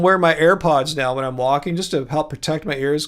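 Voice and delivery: normal talking voice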